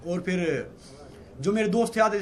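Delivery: ordinary talk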